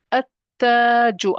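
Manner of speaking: at-taju, L silent, long held "taa"; lam dropped. Shown as a demonstration. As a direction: go straight into a doubled T in at-taju, drawn out. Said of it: "I don't say al".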